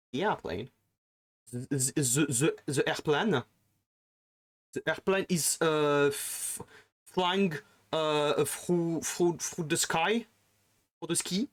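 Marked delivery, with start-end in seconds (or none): 1.5-11.5 "the" said "ze", "through" said "fru", "sky" said "ski"; hammed-up French English, slowed down; mock French accent. Mocking French speakers with a thick French accent. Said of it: "instead of saying the".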